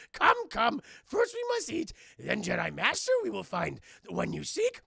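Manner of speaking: loud, high creature voice at the start, easing down; little goblin man. Retold or imitated. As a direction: beckon like an eager little sage. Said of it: "follow this little goblin man".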